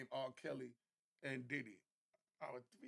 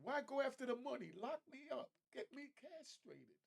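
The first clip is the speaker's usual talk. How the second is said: quoting [name]; 7 semitones higher than usual. Retold or imitated. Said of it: "But like [name] said".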